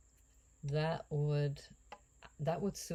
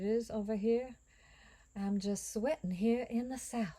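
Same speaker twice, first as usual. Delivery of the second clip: light put-on Southern accent, higher and louder; 6 semitones higher, 4 dB louder than usual. To viewers about the Southern heat, a hammed-up Southern accent. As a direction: play a Southern belle craving a mint julep, Southern accent. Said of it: "I'm working on my Southern accent".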